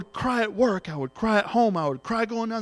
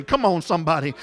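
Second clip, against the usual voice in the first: ordinary talk, nothing marked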